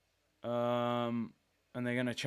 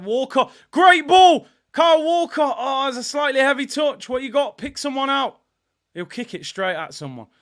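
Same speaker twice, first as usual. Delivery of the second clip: loud, high excited play-by-play; live commentary; 6 semitones higher, 11 dB louder than usual. Acted. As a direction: call the attack as it unfolds.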